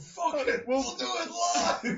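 high, loud mock meltdown; Bill O'Reilly rant. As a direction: blow up like a ranting anchor. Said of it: "a Bill O'Reilly moment".

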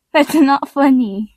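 ordinary delivery

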